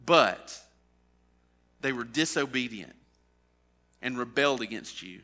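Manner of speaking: solemn, swelling preacher's reading; scripture reading. Acted. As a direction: read the Bible passage with rising force. Reads scripture aloud at sermon.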